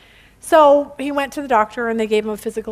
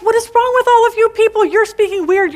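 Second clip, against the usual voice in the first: higher, louder mock outburst; exasperated foreman; 8 semitones higher, 4 dB louder than usual. Foreman to all his workers speaking weird, exasperated. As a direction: play the foreman at his wits' end, pitched up. Acted foreman losing patience with everyone.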